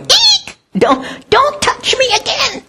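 high squeal of a frightened bush. Pretend. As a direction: squeak like a plant begging not to be cut. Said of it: "that bush crying or going".

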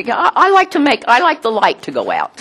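ordinary talk, nothing marked

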